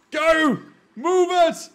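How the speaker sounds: high, loud commands to the machine; urging shout. Real, not acted. Gamer urging his contraption forward, loud yell. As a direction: yell it on like a jockey.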